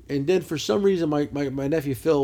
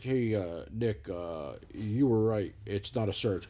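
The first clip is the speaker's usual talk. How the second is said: low, soft sheepish admission; lightly voiced salesman conceding, low and soft; 9 semitones lower, 7 dB quieter, much slower than usual. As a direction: own up quietly, a bit embarrassed. Salesman admits he was wrong.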